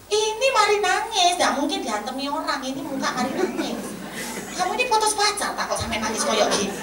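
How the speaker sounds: high, loud mock interrogation; insistent boss. Boss interrogates crying employee.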